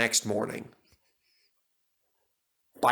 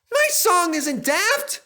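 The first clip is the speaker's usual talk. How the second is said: indignant; 5 dB louder, slower than usual. A narrator's reading. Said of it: "Percy was indignant".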